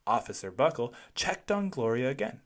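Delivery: usual speaking voice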